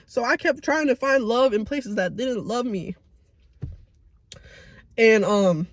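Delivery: ordinary talk, nothing marked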